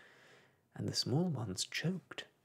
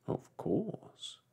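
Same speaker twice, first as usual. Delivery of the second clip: grave, higher odd bird voice; dodo voice; 8 semitones higher than usual. Acted.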